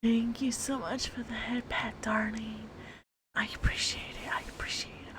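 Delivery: soft intimate whisper; asmr whisper. Acted. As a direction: whisper close and tender.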